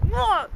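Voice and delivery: high exasperated "ugh"; exasperated retold groan; frustrated groan. Retells her irritation at being fixed.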